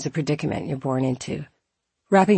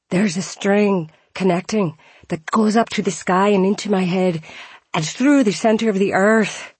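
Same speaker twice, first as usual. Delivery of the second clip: put-on Scottish accent; 4 semitones higher, 3 dB louder than usual. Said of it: "He had a thick Scottish accent".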